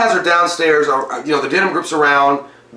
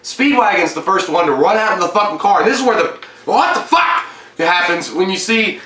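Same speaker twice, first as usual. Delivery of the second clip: ordinary talk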